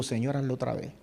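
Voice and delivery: low, soft private plea; quiet prayer. Retold or imitated. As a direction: murmur it like a prayer in the car. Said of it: "y digo".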